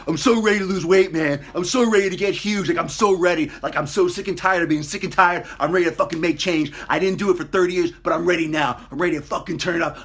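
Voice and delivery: loud, high, pumped-up rant; new gym member bragging he is ready, loud and pumped; overexcited gym newbie. Retold or imitated. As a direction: hype yourself up like a gym newbie. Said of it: "really excited are the people".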